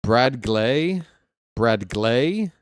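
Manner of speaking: "Brad Glay" with GLAY stressed and rising. Shown as a demonstration. Announcer trying out a strange name, questioning stress on "Glay". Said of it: "put the emphasis on the second syllable".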